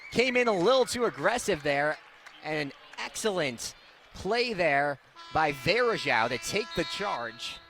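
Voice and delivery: normal talking voice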